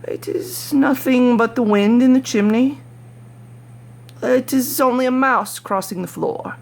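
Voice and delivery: put-on the old man reassuring himself in the dark; old man's frightened self-talk. A narrator's reading. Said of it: "He had been saying to himself".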